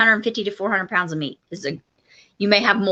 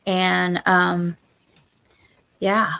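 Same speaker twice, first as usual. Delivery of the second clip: ordinary talk, nothing marked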